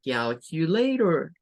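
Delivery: calculator, ending softened to -rer; English calculator. Shown as a demonstration. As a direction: calculator demonstrated slowly. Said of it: "la pronunciación para esa".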